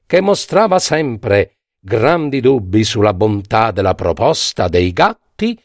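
ordinary delivery

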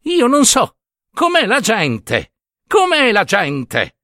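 molto più acuto, lento, incredulo; tra sé, sconsolato. Acted. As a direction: borbotta deluso, lento, a mani aperte. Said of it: "ripeteva tra sé". (il prete, rifiutato dalla folla, si lamenta tra sé lentamente)

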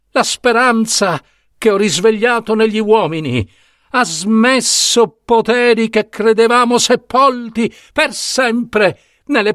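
high, pleading cry. Acted. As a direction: insist through rising despair, high. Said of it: "e gridò".

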